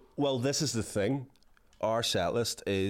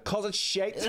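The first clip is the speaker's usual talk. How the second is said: laughing retort; 9 semitones higher, slower than usual. Retold or imitated. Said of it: "we both went".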